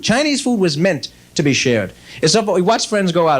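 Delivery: ordinary talk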